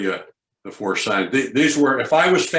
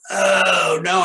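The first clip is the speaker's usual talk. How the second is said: mock whine; 7 semitones higher, 4 dB louder than usual. Shown as a demonstration. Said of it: "I don't want you to go".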